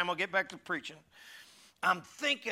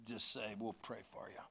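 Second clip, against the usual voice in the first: much softer, lower joke; quiet aside; 7 semitones lower, 15 dB quieter than usual. Real, not acted. Preacher jokes softly to church about praying for him. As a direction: murmur it as a sly joke.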